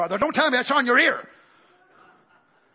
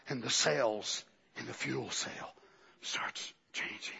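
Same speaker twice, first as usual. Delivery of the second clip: awed whispering; hushed whisper; 7 semitones lower, 12 dB quieter than usual. Real, not acted. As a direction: draw them in with a whisper. Whispered mystical climax.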